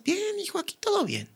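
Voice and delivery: dad's gentle reply on the phone, high and soft; imitating his dad. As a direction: play the calm dad answering sweetly.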